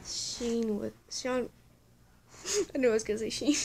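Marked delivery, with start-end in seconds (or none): 2.2-3.7 real laughing, quick and loud. Real, not acted. Reader catching their own name slip, laughing.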